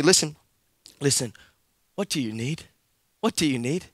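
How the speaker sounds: put-on calm the kids down softly; soothing a child who is upset; gentle parent. Parent calming screaming kids.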